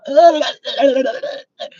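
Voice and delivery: a high, breathless laughing fit; real helpless laughter; wheezing laugh. Real, not acted. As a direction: crack up until it squeaks.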